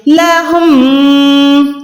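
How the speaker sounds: لَهُمْ with the م hummed through the nose; لَهُمْ slow, long nasal hum on م; nasal ghunna. Shown as a demonstration. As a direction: hold لَهُمْ's م nasally for a second. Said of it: "آواز کو ناک میں لے جائے".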